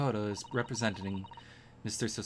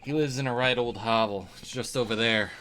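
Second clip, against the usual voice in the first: louder Southern American inspector; Southern accent; 5 dB louder than usual. Acted. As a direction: bluff Southern US accent, pointing the way. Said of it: "Why am I doing Southern?".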